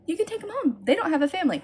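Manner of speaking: put-on high, coaxing voice for children; sweet talk to kids. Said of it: "I'm just like".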